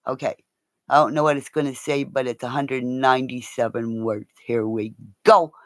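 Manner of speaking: usual speaking voice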